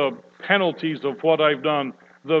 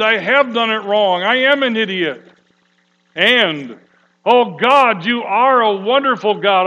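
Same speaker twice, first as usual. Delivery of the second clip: loud, high, impassioned crying-out prayer; pouring out prayer; 5 semitones higher, 7 dB louder than usual. Shown as a demonstration. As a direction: pour your whole heart out to God. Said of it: "to pour everything out".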